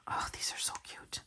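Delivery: soft unvoiced whisper. Real, not acted. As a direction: whisper it to yourself. Aside while sorting stickers.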